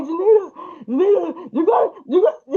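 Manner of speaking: high-pitched imitation of a panicking husband; panicked pleading. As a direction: plead urgently, as if someone may collapse. Retold husband panicking she will collapse.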